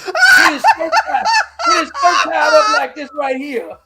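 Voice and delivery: laughing. Real, not acted. Mocking Lindsey's outfit, laughing.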